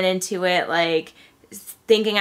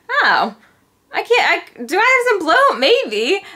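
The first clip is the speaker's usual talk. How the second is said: very high, loud startled question; surprised at herself; 11 semitones higher, 5 dB louder than usual. Retold or imitated. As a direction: catch yourself noticing, amazed. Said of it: "I was like".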